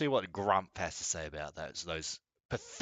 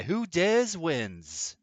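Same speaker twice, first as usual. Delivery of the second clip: slow, grand, higher announcer read; dramatic game show announcer; 6 semitones higher, much slower than usual. Shown as a demonstration. Streamer mocking the SAS motto, slow announcer voice. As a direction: savor each word like a show title. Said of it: "It sounds like that".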